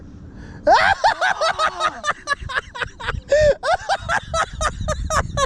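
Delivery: high crying laughter; shrieking laugh. Real, not acted. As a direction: laugh until it squeaks. Stingray reveal, shrieking laugh.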